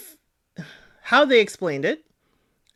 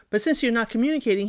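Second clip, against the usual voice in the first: usual speaking voice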